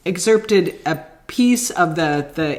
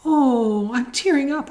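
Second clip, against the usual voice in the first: mock tearful; 8 semitones higher, slower than usual. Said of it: "afterwards I went".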